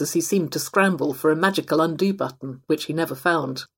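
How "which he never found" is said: ordinary delivery, nothing marked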